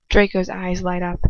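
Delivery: ordinary delivery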